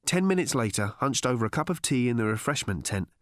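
ordinary delivery

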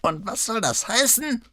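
put-on high, testy old man's retort; prickly old notary, pitched high; countering old man. A narrator's reading. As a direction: fire back as an affronted old man. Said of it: "konterte der Alte".

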